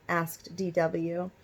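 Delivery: plain reading voice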